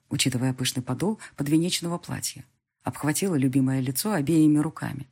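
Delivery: plain reading voice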